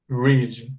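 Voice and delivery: careful ee vowel in Read, like "reed"; Read with long I. Shown as a demonstration. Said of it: "a pronúncia com som de I". (Read com som de I)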